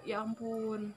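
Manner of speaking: soft, drained ya ampun under the breath; defeated sigh. Retold or imitated. Recalling a friend's harsh remark, a soft defeated sigh. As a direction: let it out like giving up. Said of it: "Cuma aku kayak".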